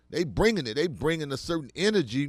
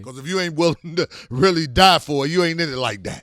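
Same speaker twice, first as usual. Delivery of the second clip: louder, higher, hard retold order; loud militant quote; 4 semitones higher, 8 dB louder than usual. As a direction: bark it like the brother laying down the law. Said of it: "He said".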